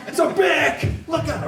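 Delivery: hammed-up panic over a pen; mock alarm. To the class, mocking a lawyer waving a pen. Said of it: "they get up and they're like".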